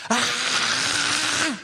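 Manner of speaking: high mock scream; a sharp mock shriek; wife's scream. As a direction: imitate her scream, short and high. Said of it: "but, like, a real scream".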